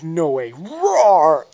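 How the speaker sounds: loud refusal rising into a roar; playful, hammed-up battle roar; defiant roar. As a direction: build from protest to a big high monster roar. Acted refusal building into a roar.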